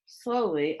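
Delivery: usual speaking voice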